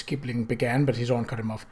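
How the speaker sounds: usual speaking voice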